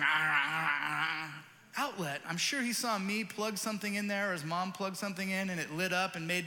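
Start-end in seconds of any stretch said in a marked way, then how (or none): 0.0-2.2 lightly voiced baby babbling; a hint of a toddler reaching; baby babble. Imitating baby reaching for outlet.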